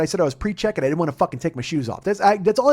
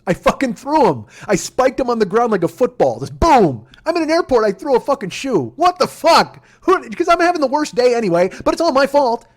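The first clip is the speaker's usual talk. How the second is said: much higher and louder, near yelling; furious rant; 7 semitones higher, 7 dB louder than usual. Real, not acted. Comic telling the crowd how he spiked his shoes at the airport, near yelling. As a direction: blow up as you relive it.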